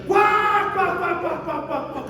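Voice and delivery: high "quá quá quá" that fades; mock cackle. Pastor imitating a woman laughing nonstop, as a mock cackle.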